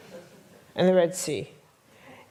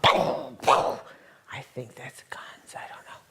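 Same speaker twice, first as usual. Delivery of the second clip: whispered aside; 7 semitones lower, much slower than usual. Retold or imitated. Fear during riot.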